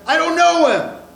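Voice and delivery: adamant denial. Retold or imitated. Peter denies Jesus a third time, adamant. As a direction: deny it hard, almost cursing. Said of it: "This time a little more adamant".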